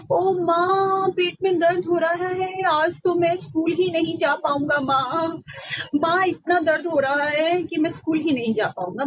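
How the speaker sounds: milk the fake stomach ache; faking pain. Ram pleads with mother to skip school, mock whine. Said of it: "माँ भागी भागी आई".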